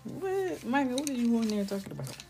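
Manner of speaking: high, fast baffled teacher voice; a teacher baffled by a toddler's talk; puzzled teacher. Retold or imitated. Preschool teacher questioning child.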